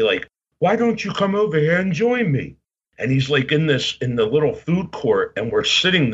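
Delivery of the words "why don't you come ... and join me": higher-pitched imitation of a wrestling personality; Lord Alfred Hayes. Wrestler inviting a fan to sit with him, imitated in a higher voice. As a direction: put on his higher voice for the invitation. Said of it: "And he said to me".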